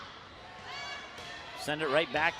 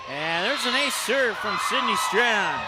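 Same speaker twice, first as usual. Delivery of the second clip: louder, slower, drawn-out ace call; excited call; 3 semitones higher, 6 dB louder than usual. Real, not acted. Announcer calls Strand's ace to viewers, excited. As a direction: savor the ace as it lands.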